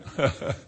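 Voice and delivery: short voiceless chuckle. Real, not acted. Preacher amused by the slang word he found, short chuckle. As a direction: chuckle briefly under the breath.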